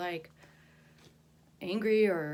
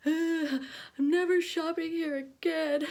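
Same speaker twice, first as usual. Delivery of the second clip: high, loud crying wail; sobbing outburst; 9 semitones higher, 6 dB louder, slower than usual. Retold or imitated. To the store clerk, sobbing loudly that she is never coming back. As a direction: blurt it out through tears, pitched up and loud. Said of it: "I just started crying".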